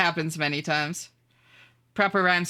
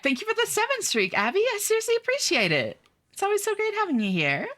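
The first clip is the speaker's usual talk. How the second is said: bright high-pitched streamer greeting, much higher than usual. Acted. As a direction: chirp a cheerful hello to chat in a high voice. Thanks viewer in high voice.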